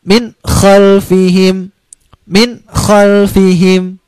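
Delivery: slow min khalfihim, the n distinct before kh; clear izhar. Shown as a demonstration. Teacher reciting the correct example, slow and clear. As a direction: draw out min khalfihim, n clean.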